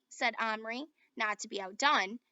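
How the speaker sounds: plain reading voice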